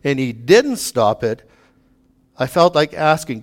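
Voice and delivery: ordinary talk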